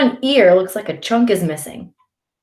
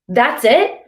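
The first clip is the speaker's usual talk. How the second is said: higher, louder indignant question; demanding; 5 semitones higher, 5 dB louder than usual. A narrator's reading. Brother confronting Ali over his apology, demanding. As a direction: challenge a weak apology. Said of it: "my brother demands".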